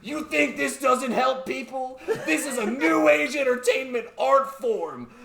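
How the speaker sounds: high, loud mock outrage; retold tirade. Presenter defending his games to scientist, mock tirade. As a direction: go off on him, half laughing. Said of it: "goes fucking off on him".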